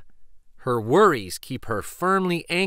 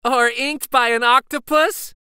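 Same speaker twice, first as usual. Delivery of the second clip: higher, louder mocking tease; teasing; 9 semitones higher, 6 dB louder than usual. Second boy joins teasing, mocking tease. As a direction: poke fun at her. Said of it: "teases Remy".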